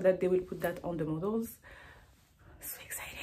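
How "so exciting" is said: breathless whispered thrill; excited whisper. Real, not acted. Designer before her runway show, whispering her excitement to viewers.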